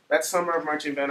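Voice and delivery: ordinary delivery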